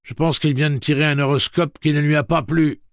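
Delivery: loud, high projected speech. A narrator's reading. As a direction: talk over a racket. Eddie talks over the din.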